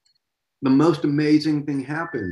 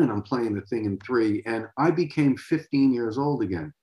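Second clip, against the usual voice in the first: ordinary talk, nothing marked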